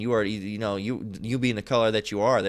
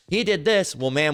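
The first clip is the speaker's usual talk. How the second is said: ordinary delivery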